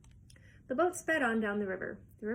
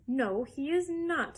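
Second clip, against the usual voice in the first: slow, louder firm defense; firm denial; 5 semitones higher, 5 dB louder, slower than usual. Acted. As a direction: plant your feet and deny it. Grandpa Joe defending Wonka.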